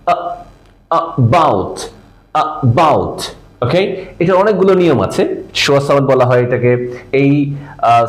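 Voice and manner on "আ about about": about split as a tiny "uh", then "BOUT"; quick uh, brief gap, full falling "bout" in about; very short schwa. Shown as a demonstration. Teacher models about, first sound very short. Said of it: "খুব short".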